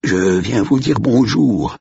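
high, slow, choked quaver; quavering strangled voice. A narrator's reading. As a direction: squeeze it out as if your neck is pressed. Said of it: "chevrota-t-il, la voix étranglée". (voix chevrotante et étranglée)

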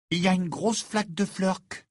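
put-on high girl's voice giving a friendly warning; warning Ginny. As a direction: voice Ginny, tipping him off.

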